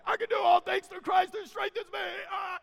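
high, strained caricature of a pumped-up athlete; mock hype shout. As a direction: bellow the verse like an over-hyped jock. Mocking athletes' misuse of the verse.

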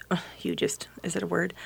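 whispered self-doubt; whispering. Real, not acted. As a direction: whisper the word unsure. Unsure of the word.

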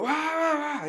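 high squealing mock panic wail. Friend mocking the reaction the character didn't have, high mock wail. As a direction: squeal it like a startled cartoon. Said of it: "jump up and go".

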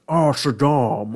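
slow, drawn-out put-on devil voice; South Park Satan. Host testing a Satan voice, slow cartoon devil. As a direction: draw it out like a lovelorn cartoon devil. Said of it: "the South Park Satan".

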